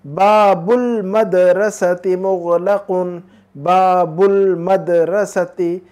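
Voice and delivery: बाबुल मदरसती मुगलकुन said very slowly, each syllable spelled out; drawn-out word-by-word model of बाबुल मदरसती मुगलकुन; careful model reading. Shown as a demonstration. Teacher modelling the sentence for the student, very slowly. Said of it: "ये यूं होगा".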